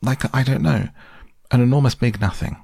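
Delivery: high-pitched, groping for the words; flustered Piglet. Acted. Piglet tells Christopher Robin what he saw, flustered. Frightened Piglet describes the creature.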